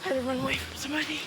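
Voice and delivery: panting after running; breathless, catching his breath. Real, not acted. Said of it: "I'm out of breath".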